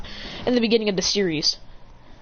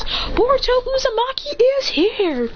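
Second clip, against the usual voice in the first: high, drawn-out hero entrance call; heroic announcement; 14 semitones higher, slower than usual. Acted. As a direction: proclaim your arrival, stretching it out. Second hero announces his arrival.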